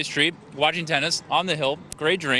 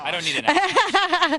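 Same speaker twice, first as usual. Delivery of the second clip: laughing; 14 semitones higher, slower than usual. Real, not acted. Jokey refusal while eating.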